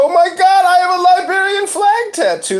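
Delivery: high, loud storytelling outburst; acted excitement. Acted excited reveal to a driver.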